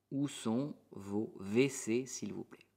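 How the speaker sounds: slow question fading at the end; slow model question. Shown as a demonstration. Teacher models asking a host for the toilets. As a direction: ask slowly, letting it trail off. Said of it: "en una frase".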